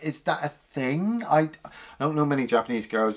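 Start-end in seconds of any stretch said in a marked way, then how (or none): none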